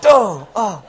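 loud, laughing dim-witted duh; dopey zombie groan. Retold or imitated. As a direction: play a disappointed dumb zombie. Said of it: "Be like".

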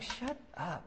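hushed mock irritation; mock exasperation. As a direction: say it under your breath, fed up. Imitates exasperated listener.

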